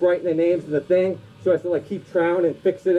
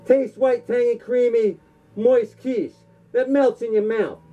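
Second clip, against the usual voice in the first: hammed-up dubbed kung fu film voice, higher; kung fu movie villain; 4 semitones higher than usual. Comic sketch parodying a dubbed kung fu film, hammed-up villain voice. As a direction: ham it up like a badly dubbed martial arts movie.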